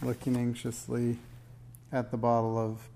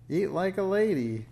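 shrill high witch shrieking at her; high, loud, scolding witch cry; screamed witch voice; 7 semitones higher, 5 dB louder than usual. A narrator's reading. Witch screeching at Minx about her manners. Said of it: "screamed the witch".

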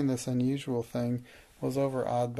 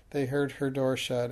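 ordinary delivery

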